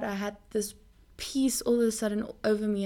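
ordinary talk, nothing marked

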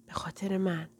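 a soft whisper as from a well; faint whisper. Acted. Sick sister pleading from under the quilt, whispering. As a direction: breathe it out, barely there. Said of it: "آهسته، انگار از ته چاهی".

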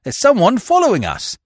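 a servant's urgent, frightened call; alarmed warning. A narrator's reading. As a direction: warn the others there's a pursuer. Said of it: "cried Kennedy".

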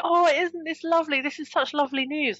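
high, sugary imitation of delighted friends; imitating friends who coo over her romance; gushing friends. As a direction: coo the praise, mocking their delight. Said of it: "people were like".

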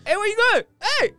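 very high, loud reenacted shout; calling out. Driver calling after a trainee leaving the van, shouting. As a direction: holler after someone walking away.

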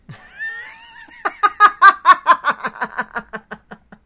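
a high, loud cackle breaking out; burst of laughter. Real, not acted. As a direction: laugh hard at your own punchline. Reacting to own punchline.

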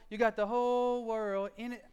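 put-on playful baby voice; baby thing. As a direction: sing it like a small child. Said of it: "I did the baby thing".

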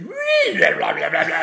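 squealing high then loud babble; goofy third-grader noise in class; silly kid noises. Retold or imitated. Kid imitating Ace Ventura in class, shrill babble. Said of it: "just be like".

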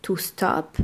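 British stop; "stop" with a round O; British English. Shown as a demonstration. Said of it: "le O se dira un peu plus A". (en anglais britannique, "stop" avec un O rond)

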